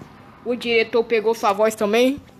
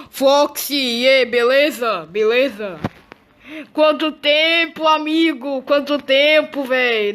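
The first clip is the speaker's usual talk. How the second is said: higher, slow, drawn-out happy greeting; joyful reunion; 4 semitones higher, 4 dB louder, slower than usual. Real, not acted. Player reunites with old friend Fox, overjoyed. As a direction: stretch every word with delight.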